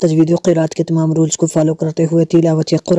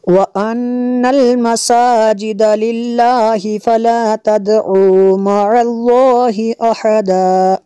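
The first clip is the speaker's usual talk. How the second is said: Quran recitation; 4 semitones higher, much slower than usual. Shown as a demonstration. Full verse recitation.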